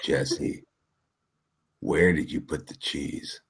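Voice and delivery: very low, slow, breathy Swedish voice; low sexy voice. Shown as a demonstration. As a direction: drop deep and drag out the words. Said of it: "talk with your lowest Swedish voice".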